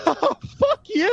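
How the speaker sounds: very high, slow mock brush-off; Stifler's dismissive jab. Host imitating Stifler telling Sherman off, high and slow. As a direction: fling it off in a high cartoonish voice. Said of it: "he's like".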